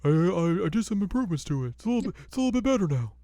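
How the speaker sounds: put-on high, fast, sheepish old smith; Totosai. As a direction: rattle it off like a guilty old smith.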